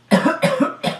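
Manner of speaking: high, put-on coughing fit; feigned feverish hacking; fake sick cough. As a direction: cough to convince mom you're ill. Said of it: "Sabihin ko na lang, nilalagnat ako".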